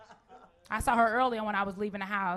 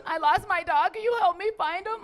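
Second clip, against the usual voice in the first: panicked, higher-pitched plea; mock a hysterical dog owner begging for help; hysterical woman; 6 semitones higher than usual. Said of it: "She was hysterical".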